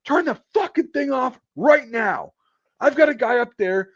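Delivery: high, loud furious boss; imitating an enraged, high-pitched tirade; screaming. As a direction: scream an urgent order at the culprit. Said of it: "he's screaming at him".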